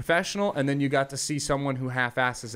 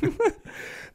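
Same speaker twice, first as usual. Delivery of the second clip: high breathy laugh; 3 dB quieter than usual. Real, not acted. Laugh after the bit.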